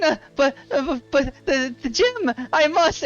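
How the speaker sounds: high, stammering desperate plea; frantic, high-pitched begging; desperate halfling. Acted. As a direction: stutter upward in mounting desperation. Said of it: "And he goes".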